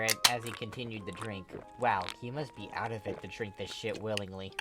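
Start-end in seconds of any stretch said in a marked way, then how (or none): none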